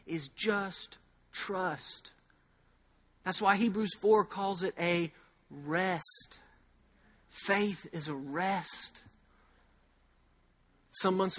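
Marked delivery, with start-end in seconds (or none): 0.0-2.1 soft slow. Real, not acted. Preacher slows to land point. 7.3-9.0 soft slow. Real, not acted. Preacher slows to land point.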